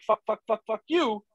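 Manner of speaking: body's voice. Retold or imitated. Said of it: "and your body's like".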